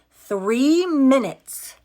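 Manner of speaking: three minutes said slow, high and loud; stressed. Real, not acted. Telling viewers how close it was, slow emphatic. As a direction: drag out Three minutes for drama.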